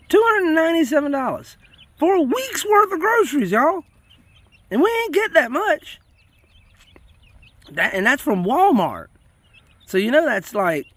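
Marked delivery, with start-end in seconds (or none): 0.1-6.0 high, loud outraged exclamation; can't believe the price, cry it out high; shocked grocery-bill rant; indignant rant. Real, not acted. Ranting about grocery prices.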